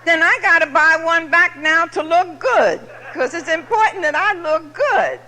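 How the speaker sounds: loud laughing telling. Real, not acted. Speaker laughing at her drinking logic, loud laughing delivery. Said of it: "laughing at herself".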